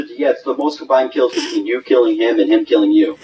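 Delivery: usual speaking voice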